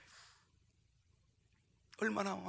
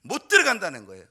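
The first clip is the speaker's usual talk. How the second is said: "못 들어간다는 거예요" said louder, falling hard on 들어간다는; emphatic retelling; 14 dB louder than usual. Retold or imitated. Pastor stressing the boy's rejection, loud and firm. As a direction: punch the refusal out. Retelling the child turned away.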